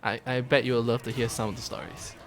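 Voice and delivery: usual speaking voice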